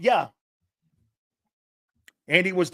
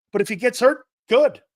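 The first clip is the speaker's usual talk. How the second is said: coldly shrugging off an injury, good rising louder; cold and uncaring; 7 semitones higher, 6 dB louder than usual. Real, not acted. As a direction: say good like you couldn't care less. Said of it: "[name], that sounds so cold".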